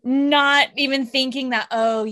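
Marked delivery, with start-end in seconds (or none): none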